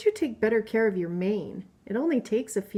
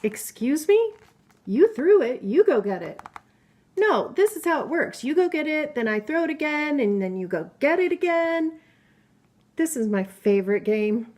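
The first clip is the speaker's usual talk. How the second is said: lively, higher voice for each storybook animal; playful book characters; 3 semitones higher, 4 dB louder than usual. Acted. Mother reading a picture book to her child, lively character voices. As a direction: read the animal friends' squabble with bounce.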